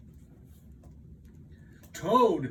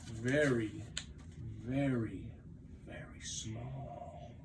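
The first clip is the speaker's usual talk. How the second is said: fading away; 4 semitones lower, 5 dB quieter than usual. A narrator's reading. Narrating Frog shrinking.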